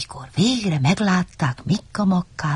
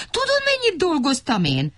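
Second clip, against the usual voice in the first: high, affronted question; piping, put-upon little fellow; indignant small character; 12 semitones higher than usual. A narrator's reading. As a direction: protest in a high voice. Interrupting in indignant protest.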